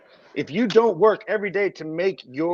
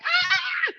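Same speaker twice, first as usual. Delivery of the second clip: mock scream. Everybody fleeing an angry wife, mock scream. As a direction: imitate a panicked crowd shrieking.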